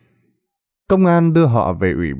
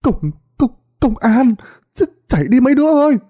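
stammered panic; 12 semitones higher than usual. Acted. Old gambler stammers a warning to the players, high panicked shout. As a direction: stumble over the words, then yell to run. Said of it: "Bà Sáu lắp bắp".

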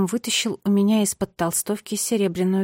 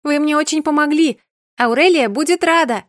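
high, loud happy call; 7 semitones higher, 7 dB louder than usual. A narrator's reading. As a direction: shout after them gladly. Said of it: "крикнула я".

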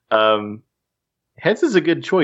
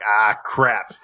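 Hellboy grumble. Retold or imitated. Acting out Hellboy's exclamation.

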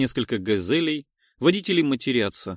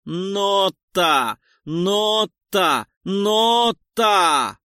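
loud, higher, pulsing shouts; rhythmic shouting; 8 semitones higher, 6 dB louder, much slower than usual. Acted. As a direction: shout each word like a push. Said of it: "Нота снова кричит".

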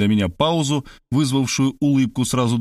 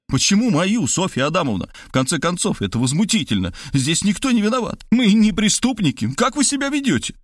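high-pitched, outraged protest; flaring up; 9 semitones higher than usual. A narrator's reading. Narrator protests to Sofya Adamovna, flaring up. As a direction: climb into heated indignation. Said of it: "взвился я".

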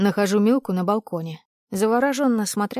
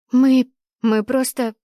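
flustered stammer; 4 semitones higher, 6 dB louder, slower than usual. A narrator's reading. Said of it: "Тотчас краснеет".

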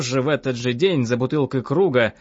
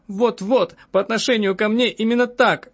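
high, excited woman's outcry; agitated high exclamation; crying out; 8 semitones higher than usual. A narrator's reading. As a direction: agree vehemently, voice pitched up. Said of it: "закричала я".

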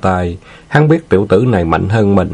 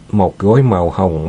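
ordinary delivery, nothing marked

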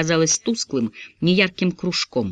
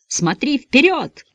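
terrified scream; 9 semitones higher, much slower than usual. Acted. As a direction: scream it in panic. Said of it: "заорала в смертельном ужасе".

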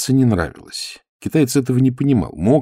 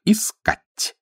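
terse order; 12 semitones higher than usual. Acted.

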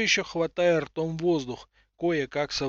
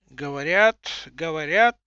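put-on old woman voice; 7 dB louder than usual.

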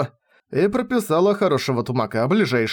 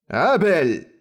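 higher-pitched, restrained roar of a name; 4 semitones higher than usual. A narrator's reading. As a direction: call him sternly to account. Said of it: "проревел он". (грозный, но сдержанный окрик)

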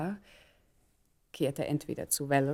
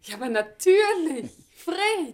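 laughs, then a much higher "Fred"; delighted recognition through a laugh; a high, laughing hello; laughing greeting; 10 semitones higher, 4 dB louder, slower than usual. Acted. Surprised reunion, laughing greeting.

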